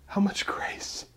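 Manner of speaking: hushed, breathless wonder; soft whisper of amazement; awed whisper. Real, not acted. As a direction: whisper it in awe. Awed aside after sermon peak.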